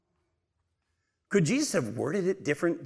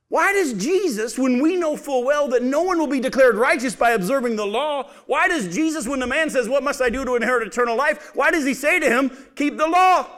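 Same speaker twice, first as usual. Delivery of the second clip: higher and louder, hammering each question; preaching at full force; 8 semitones higher, 5 dB louder than usual. Acted. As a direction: press the congregation with rising urgency. Rising preaching climax.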